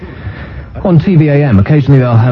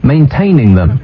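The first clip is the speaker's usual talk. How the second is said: usual speaking voice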